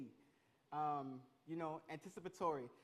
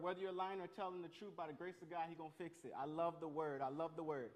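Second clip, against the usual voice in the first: ordinary talk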